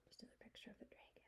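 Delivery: quick whisper to the class; whispered aside. Real, not acted. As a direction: hush it as a side note. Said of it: "teacher's whispered aside".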